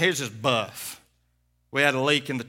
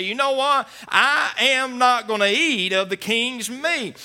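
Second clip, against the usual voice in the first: mock bold refusal, pitched high; defiant refusal; 10 semitones higher, 7 dB louder, slower than usual. Daniel's boys refusing the king, mock defiance. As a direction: plant your feet and refuse the king's meat. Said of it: "and say".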